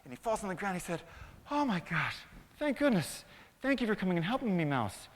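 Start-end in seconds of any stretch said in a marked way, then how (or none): 1.5-5.1 high, loud, rushing gratitude; relieved lion. Acted. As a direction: gush thanks after a rescue. Freed lion thanks the mouse.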